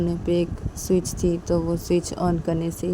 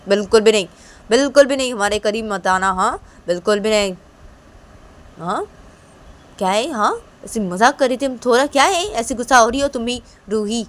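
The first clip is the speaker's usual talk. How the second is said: louder, higher playful refusal; defiant teasing; 5 semitones higher, 7 dB louder than usual. Acted. Father holding daughter refuses wife, defiant. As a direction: stand your ground jokingly.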